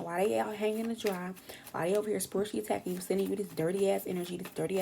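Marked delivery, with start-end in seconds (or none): none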